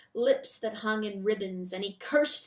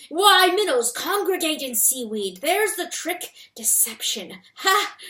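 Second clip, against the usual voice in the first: gleeful fox; 7 semitones higher, 7 dB louder than usual. A narrator's reading. Fox gleeful about trick.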